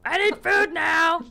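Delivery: high, loud, demanding pet; hangry cat voice. Retold or imitated. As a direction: yell for dinner like a hungry cat. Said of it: "that's Pebbles' human voice".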